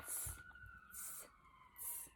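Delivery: soft whispered monkey tss; monkey hiss. Acted. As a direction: hiss back like a cheeky monkey. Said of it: "only shook both their hands back at him and said".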